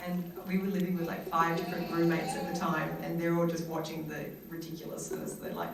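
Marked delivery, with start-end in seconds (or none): none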